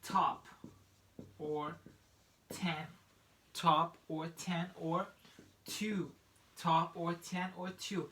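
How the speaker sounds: "top", "ten", "two" with a crisp aspirated T; regular T. Shown as a demonstration. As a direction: puff of air on each T in "top", "ten", "two". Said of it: "regular T".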